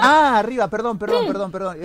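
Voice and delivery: high laughing sorry; laughing apology. Real, not acted. Laughing apology after flubbing line.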